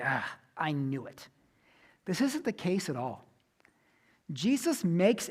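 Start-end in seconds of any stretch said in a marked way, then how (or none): 0.0-1.3 disappointed. Pretend. Imagined disappointed Jesus.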